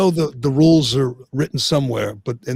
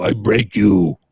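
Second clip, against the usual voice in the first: light mock Russian boxer accent, a bit lower; Ivan Drago; 4 semitones lower, 4 dB louder, slower than usual. Host jokingly imitating the Rocky villain, light mock Russian menace. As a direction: toss off a quick Russian villain threat. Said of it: "You can imitate Drago".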